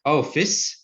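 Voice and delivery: ordinary talk, nothing marked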